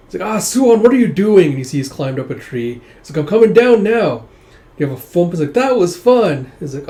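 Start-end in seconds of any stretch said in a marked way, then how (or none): 0.3-1.5 high, loud acted alarm; alarmed exclamation. Mother spots son up a tree, alarmed. 3.2-4.3 put-on high, loud child's call; little boy calling. Son answers mother from tree, boyish calling. 5.5-6.5 put-on high, louder gleeful child voice; excited little boy. Boy just jumped from tree, excited.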